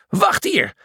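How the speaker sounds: high, tense command; high-pitched, urgent growl; growled order. A narrator's reading. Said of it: "gromde hij".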